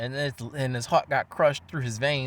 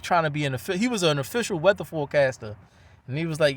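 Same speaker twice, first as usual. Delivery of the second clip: ordinary delivery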